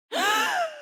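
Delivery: high, sharp intake of breath; sudden gasp. Acted. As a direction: gasp as if seized. Seized by sudden shock.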